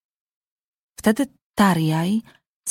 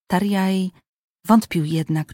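plain reading voice

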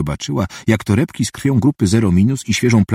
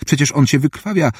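higher-pitched, mildly incredulous objection; astonished protest; 6 semitones higher than usual. A narrator's reading. Assistant objecting that the patient is bleeding out, astonished protest. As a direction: object in puzzled disbelief, pitch lifted. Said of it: "zdumiał się Barrows".

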